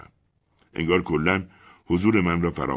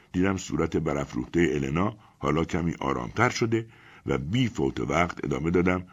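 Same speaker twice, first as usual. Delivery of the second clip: ordinary delivery, nothing marked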